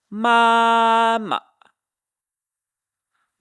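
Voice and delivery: first MA long, high and level, second short; toneless fifth tone. Shown as a demonstration. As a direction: hold Ma high, tack on a quick toneless ma. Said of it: "kind of like a toneless sound".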